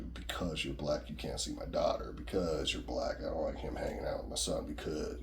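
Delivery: low, soft, half-whispered disapproving parent; a hint of a cold, judgmental parent, hushed.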